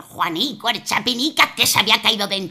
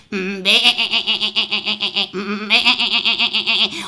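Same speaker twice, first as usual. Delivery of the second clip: laughing mock bleat; goat bleating.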